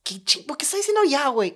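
high-pitched incredulous why is he saying ya; baffled outburst. Retold or imitated. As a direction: act out your own confused reaction. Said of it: "Y yo como".

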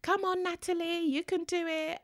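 high, bright mock crowd cheer. Spectators urging the runner on, high cheering. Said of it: "you've got your name".

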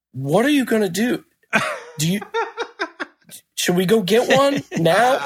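high-pitched, fretful pestering; mock breakdown. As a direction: imitate a guy losing it over something trivial. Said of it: "dude had a mi-, a breakdown".

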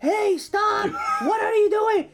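imitated girl's high-pitched loud shout. Girl shouting in the driver's ear to stop, shouted imitation. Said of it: "she was fucking shouting in my ear".

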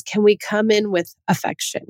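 ordinary talk, nothing marked

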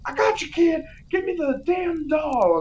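high put-on voice grabbing for the doll; villain Sinbad. Sinbad ordering the kid to hand over the doll, high put-on voice. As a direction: play Sinbad demanding the toy. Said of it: "Sinbad's like".

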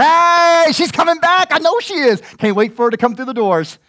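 high, loud eager calling; father watching for his child; joyful father. Retold or imitated. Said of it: "like that father going".

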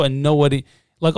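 ordinary talk, nothing marked

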